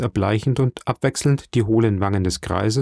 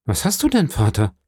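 alarmed son, pitch jumping up then falling; beunruhigt; 4 semitones higher than usual. A narrator's reading. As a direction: call out in worry to father. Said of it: "rief der junge Mann beunruhigt". (besorgt gerufen)

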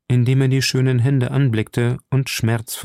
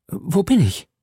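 dazed waking; 8 semitones higher than usual. Acted. Milady erwacht aus Ohnmacht.

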